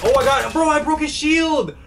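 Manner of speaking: very high, slow triumphant yell; excited shout. Real, not acted. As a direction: stretch it out in triumph. Celebrating a hit.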